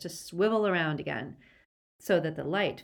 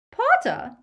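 very high, loud, astonished; piercing startled woman's cry; Bellatrix's shriek; 9 dB louder than usual. A narrator's reading. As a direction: shriek the question. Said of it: "shrieked Bellatrix".

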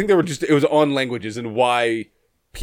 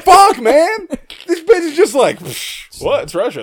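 laughing; 11 semitones higher, 4 dB louder than usual. Retold or imitated. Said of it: "And he was like".

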